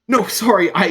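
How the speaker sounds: very high, rushed, overjoyed voice; Zolt's excited greeting. Acted. Zolt hugging Morgaine after years apart, very high and excited. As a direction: squeal it out like an excited little friend.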